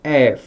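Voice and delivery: "एफ" opening on a half uh gliding into eh; "एफ" with an open, gliding first vowel; English letter F. Shown as a demonstration. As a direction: start "एफ" with a short open uh. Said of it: "आधा अ".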